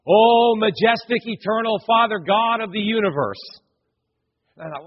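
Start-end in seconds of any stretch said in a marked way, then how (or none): 0.1-3.4 grandiose prayer. Retold or imitated. Preacher imitating a young man's opening prayer, grandiose. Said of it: "his prayer went something like this".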